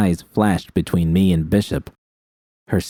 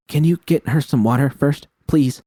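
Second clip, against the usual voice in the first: a higher, pleading "please?" with a falling finish; 7 semitones higher than usual. A narrator's reading. Captive pleading with Bishop for water, higher and anxious. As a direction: plead with him, voice lifted and anxious. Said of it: "I pleaded".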